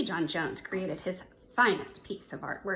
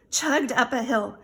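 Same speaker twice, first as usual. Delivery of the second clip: plain reading voice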